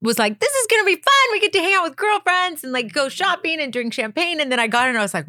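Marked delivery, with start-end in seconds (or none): 0.4-4.4 put-on high, bubbly rush of girly excitement; thrilled, high-pitched retelling of past hopes; naively excited. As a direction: gush it in a much higher pitch. Retells her naive excitement at casting.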